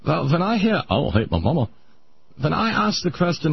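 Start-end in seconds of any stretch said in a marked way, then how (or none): 0.8-1.7 lower, lightly voiced patient accent, "I don't hate my mama" rising on "mama"; the patient's denial, "I don't hate my mama", said lower with a questioning rise; patient's accent again. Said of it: "how I'm able to do that accent".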